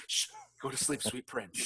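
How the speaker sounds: soft hushed mock lullaby; mock tender hush. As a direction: hush him softly like a dying prince. Tender mock farewell to stabbed man.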